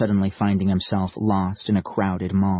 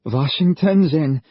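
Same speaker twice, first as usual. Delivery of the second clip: nervous snort; 7 semitones higher, 4 dB louder than usual. Acted. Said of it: "he snorted nervously".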